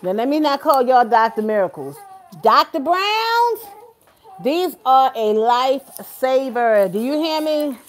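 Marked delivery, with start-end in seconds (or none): 2.4-6.9 slow, drawn-out emphatic praise. Real, not acted. Grandma slowly praising the bottles to viewers.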